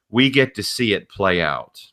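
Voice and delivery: ordinary delivery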